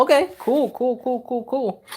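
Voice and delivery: high, bouncy repeated cool; squeaky cool. Pretend. Answering a viewer comment with squeaky repeated cools. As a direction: chirp the cools up high and quick.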